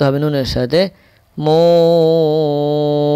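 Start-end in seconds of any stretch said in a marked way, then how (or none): 1.4-3.1 মুন chanted with a very long held u; slow, sustained মুন; prolonged madd. Shown as a demonstration. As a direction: stretch the vowel of মুন into the n. Teacher demonstrates joined syllable.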